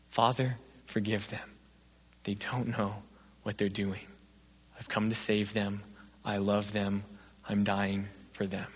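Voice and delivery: low, soft, broken into short phrases; tender plea. Acted. Preacher as Jesus dying for them, hushed and tender. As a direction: plead gently with long pauses.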